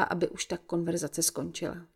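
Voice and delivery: ordinary delivery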